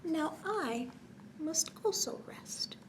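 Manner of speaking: high soft whisper. Acted. Bird settling down to sleep, whispering. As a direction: drift off on the words.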